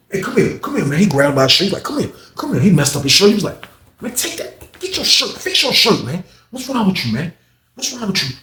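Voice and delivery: high, pushy retold voice; mock pushy inmate. Retold inmate grabbing narrator's shirt.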